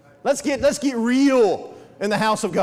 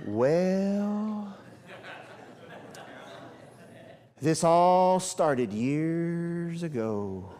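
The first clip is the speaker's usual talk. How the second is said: drawn-out, very slow storyteller voice; slow rambling talker; 5 semitones lower, 6 dB quieter, much slower than usual. Retold or imitated. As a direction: drag every word out like she has all day. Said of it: "the type of person that talked like this".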